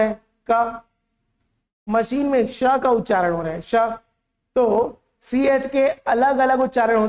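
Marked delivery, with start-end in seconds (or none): none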